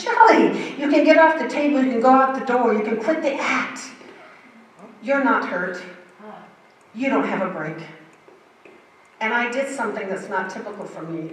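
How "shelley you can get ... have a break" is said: much higher and louder, a mock brush-off; rude condescending orderly. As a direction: imitate a rude orderly dismissing a patient. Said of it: "He's rude and condescending".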